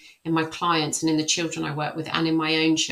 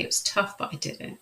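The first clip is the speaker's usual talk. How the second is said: whispered softly; whispering; 4 dB quieter than usual. Shown as a demonstration. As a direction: breathe the words out in a whisper. Whispered aside in demo.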